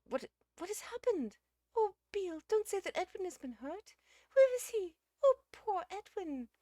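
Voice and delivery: very high, loud, frantic lady's voice; agitated. A narrator's reading. Missus Uckridge fears Edwin is hurt, agitated. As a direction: wail with worry, pitched right up. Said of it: "cried Missus Uckridge in agitation".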